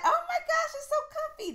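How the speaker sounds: high gushing delight. Real, not acted. Trying her new bed, gushing high. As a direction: gush over the bed.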